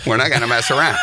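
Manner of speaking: not stressed, laughing through it. Shown as a demonstration. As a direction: laugh while hitting not.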